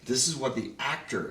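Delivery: ordinary talk, nothing marked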